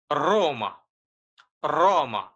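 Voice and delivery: রোমা with a strongly trilled r; rolled r. Shown as a demonstration. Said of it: "জোরে কম্পন দিয়ে উচ্চারণ".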